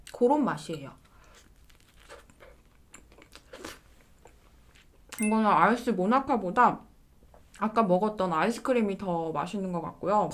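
eating ice cream wafer; chewing while talking. Real, not acted. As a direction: mouth partly full. Seen 4: Bit monaka just before; mouth works food while speaking, piece in hand.

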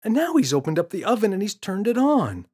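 put-on a frustrated boy pleading to be believed; insistent boy. James voiced in story.

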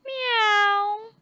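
high, drawn-out cat meow. Acted. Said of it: "kucing ni jawab".

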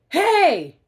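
high, loud slow angry yell; hollered. Acted. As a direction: holler it at the thief. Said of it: "hollered Pig in dismay".

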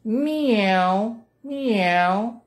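high, drawn-out meows; cat meowing. Acted. Reader voices the cat for children, crying meow. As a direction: mew like a cat, gliding down. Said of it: "cried the cat".

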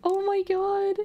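high, slow gushing coo; high excited gushing. Real, not acted. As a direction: gush over the cute creature. Cooing over cute Pokemon.

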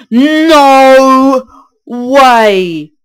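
loud, high gasp of shock; sudden realisation, blurt it out loud. Real, not acted. Gasps at realisation.

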